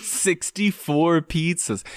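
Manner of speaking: very slow, high, drawn-out wonder; mock awe. Topping the pizza joke, slow mock awe. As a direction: stretch it out in hammed-up amazement.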